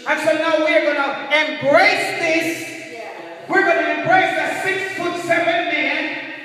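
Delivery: loud, high, emphatic sermon delivery; forceful preaching. Real, not acted. Preacher warning a church of deception, forceful preaching. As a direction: preach it out, leaning hard on key words.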